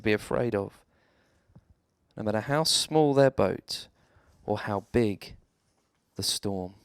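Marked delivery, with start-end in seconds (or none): none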